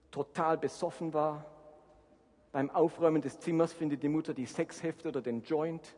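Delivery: ordinary talk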